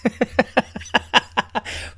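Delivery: airy high cackle at himself; cracking up at his own rant; breathy wheezing laugh. Real, not acted.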